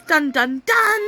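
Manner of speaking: gasp, then a loud melodramatic dun dun dun; mock dramatic gasp and dun dun dun. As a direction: ham up a soap opera sting. Dramatic reveal sound effect.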